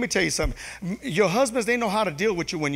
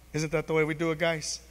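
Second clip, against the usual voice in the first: ordinary talk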